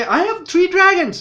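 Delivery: mock her boasting; queen bragging, imitated by the fan. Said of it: "Now she can't say".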